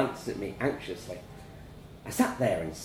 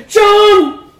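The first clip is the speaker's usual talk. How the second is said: very loud, high panicked cry; panicked scream; 20 dB louder than usual. A narrator's reading. Wife to choking husband, screaming in panic. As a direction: scream his name in terror.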